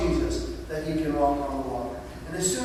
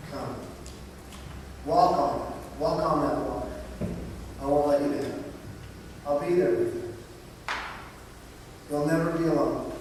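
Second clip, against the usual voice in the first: soft, low, tender invitation; gentle call of Jesus; 5 semitones lower, 7 dB quieter than usual. Acted. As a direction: coax him gently across the water. Jesus calling Peter onto water.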